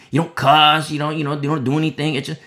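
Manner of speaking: ordinary delivery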